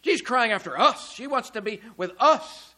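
exaggerated emphasis landing on "us"; heavy stress on "us". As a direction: overdo the word "us" as the disciples would. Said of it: "too much emphasis on the us".

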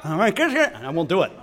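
mock mouth-obstructed mumble; pen in mouth. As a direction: imitate talking with a pen between the teeth. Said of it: "the pen in my mouth".

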